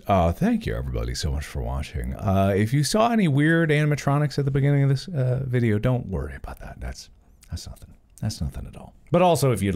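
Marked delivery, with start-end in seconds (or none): 5.8-8.9 dropping to a near whisper; hushed. Acted. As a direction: hush it like a secret. Hushed denial of weird animatronics.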